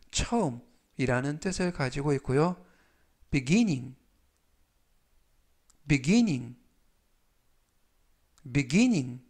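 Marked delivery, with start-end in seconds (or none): 3.3-9.2 beginning said clearly, three times; 또박또박 beginning 발음 시범; demo pronunciation. Shown as a demonstration. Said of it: "이라고 발음하시면 되겠습니다". (또렷하게 beginning 시범)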